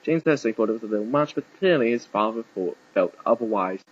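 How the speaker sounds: ordinary delivery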